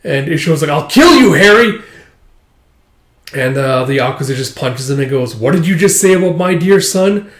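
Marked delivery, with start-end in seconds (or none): 0.7-1.8 high, loud acted threat; enraged shout. Son threatens the man who backhanded her, enraged shout. 5.3-7.3 acted anger. Yakuza confronts while punching.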